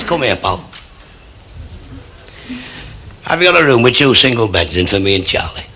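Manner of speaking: low-pitched mock drunk ordering a room; drunk hotel guest. Drunk asks reception for a room, lightly acted drunkenness.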